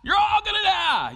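high, loud ranting yell; street preacher yelling. Retold or imitated. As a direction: scream the doom like the corner guy. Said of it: "he was basically saying".

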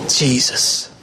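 breathy hushed answer, low; hushed reverent reply; whispered answer. Real, not acted. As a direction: drop to a whisper for the name. Answering his own question, hushed.

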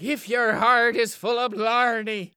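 high, loud mock Irish revival preacher. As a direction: ham up a lilting Irish sermon voice. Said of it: "He's like".

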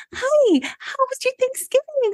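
very high, bubbly over-the-top hairstylist cheer; sugary fake-happy chirp; peppy fake-cheerful greeting. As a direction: squeal it like a hyper-perky salon girl. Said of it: "be that happy, peppy".

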